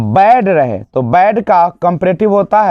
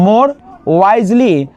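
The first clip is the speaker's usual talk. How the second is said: "more wisely" said very slowly, word by word; slow model answer; much slower than usual. Shown as a demonstration. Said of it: "इसका comparative बनाने के लिए आप क्या करते हैं".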